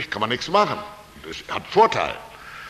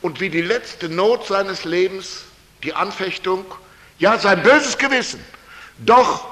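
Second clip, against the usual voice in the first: usual speaking voice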